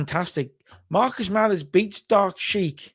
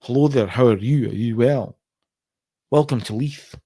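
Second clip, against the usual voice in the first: put-on lower, polite posh Edinburgh Scottish accent; 6 semitones lower than usual. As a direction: greet them like a posh Edinburgh gent. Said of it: "sort of more posh, uh, Edinburgh accent".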